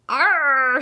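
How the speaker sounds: a loud cartoon "Arr!"; mock shout. A narrator's reading. Babaroo reacting to the icy toothpaste, loud yelp. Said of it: "shouts Babaroo".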